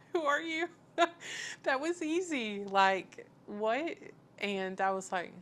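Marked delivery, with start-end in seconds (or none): 0.1-0.7 high, loud squeal of disbelief; shocked laughing squeal. Retold or imitated. Shocked at husband's easy yes. 1.0-1.6 a short, high, loud laugh of disbelief; high burst of laughter. Real, not acted. Laughs after husband's answer. 1.6-4.0 high, loud, laughing disbelief; amazed disbelief. Real, not acted. Disbelief at easy agreement.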